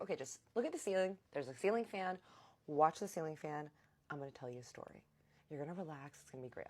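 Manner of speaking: soothing voice settling into a hush; calm, soothing. Retold or imitated. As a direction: coax them gently into relaxing. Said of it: "listening to his calm voice".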